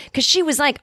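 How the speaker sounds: normal talking voice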